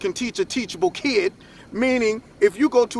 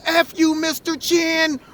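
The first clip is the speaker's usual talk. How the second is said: imitated insolent yell; 7 semitones higher, slower than usual. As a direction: yell it slowly at the teacher. Kid yelling at teacher via bullhorn.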